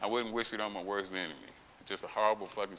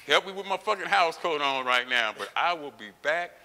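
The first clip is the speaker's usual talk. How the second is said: raised, emphatic retort; defiant vow; 6 semitones higher, 6 dB louder than usual. Retold or imitated. Sick patient vowing to the doctor to return, defiant. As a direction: throw the promise back at them, loud and high.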